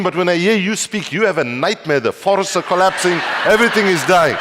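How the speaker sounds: acting out a loud, high-pitched complaint; exasperated audience member yelling, pitched high; shouted rebuke. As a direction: keep shouting back, building to a despairing cry. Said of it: "she shouted back".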